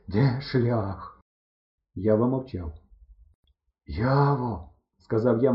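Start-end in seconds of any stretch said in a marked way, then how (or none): 0.1-1.2 winded, tired question; panting harder. A narrator's reading. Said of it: "хекаючи ще дужче". 3.9-4.7 gasped, weary call; nearly breathless. A narrator's reading. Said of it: "майже задихаючись".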